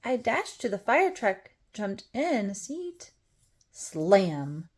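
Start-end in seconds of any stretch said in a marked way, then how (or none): none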